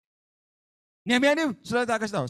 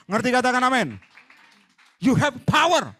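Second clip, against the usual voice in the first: preaching shout; 3 semitones higher, 5 dB louder than usual. Real, not acted. Preacher rallying the congregation, a loud rousing shout. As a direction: fire up the room with it.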